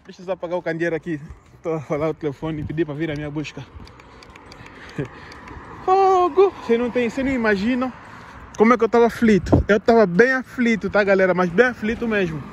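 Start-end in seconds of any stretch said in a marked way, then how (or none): 5.8-6.5 high sudden outburst; exasperated exclamation. Real, not acted. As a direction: blurt it out, exasperated. Exclaims remembering the worry.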